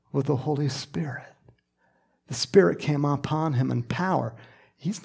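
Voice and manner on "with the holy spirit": unvoiced, reverent hush; awed whisper. Real, not acted. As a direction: drop to a whisper of awe. Awed emphasis in sermon.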